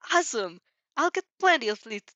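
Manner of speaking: bubbling over with excitement; excited. A narrator's reading. Athena telling the principal she can't wait.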